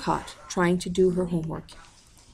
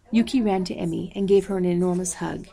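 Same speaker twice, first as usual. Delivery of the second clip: ordinary delivery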